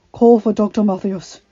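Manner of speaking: loud, raspy command; rasping order. A narrator's reading. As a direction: rasp out the order urgently. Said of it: "he rasped".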